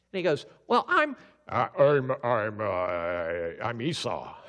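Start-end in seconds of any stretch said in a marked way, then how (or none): 0.7-1.2 much higher, louder squeak; slipping into Jacob voice. Acted. Jacob slips back into his own high voice before his father. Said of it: "your Jacob voice". 1.5-4.4 hesitant, pushed much lower; fake Esau voice. Jacob recovers and claims to be Esau in a low voice. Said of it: "talk in an Esau voice".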